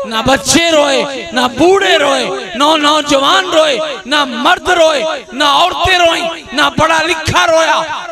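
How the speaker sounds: rising shouted litany; building outcry. Acted. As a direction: hammer each line harder to the crowd. Rising list, crowd preaching.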